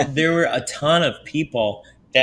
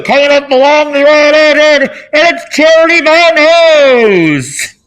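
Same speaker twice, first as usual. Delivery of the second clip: much higher, louder, slow sing-song announcing; mock announcer; 13 dB louder, slower than usual. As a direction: bellow it out like a ringside announcer introducing her.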